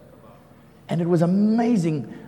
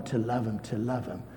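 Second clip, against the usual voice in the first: fading repetition; 8 semitones lower, 9 dB quieter than usual. Acted. Repeated, fading.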